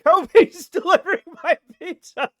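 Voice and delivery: high, loud words broken by laughter; laughing through words. Real, not acted. Streamer tells chat who is delivering his pizza, laughing. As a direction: laugh while saying it.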